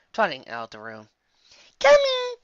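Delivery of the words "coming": put-on high, loud eager kid shout; child's yell. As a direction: call back in a piercing child voice. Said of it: "I yell".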